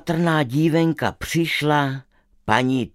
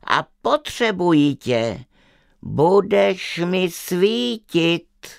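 witch; 7 semitones higher than usual. Acted. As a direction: savour each word like a pleased witch.

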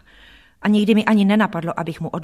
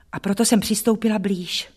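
ordinary delivery

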